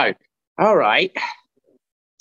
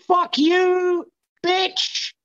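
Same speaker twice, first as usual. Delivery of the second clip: mock insult shout; much slower than usual. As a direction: yell it at a friend for fun. Mock insult to friend abroad.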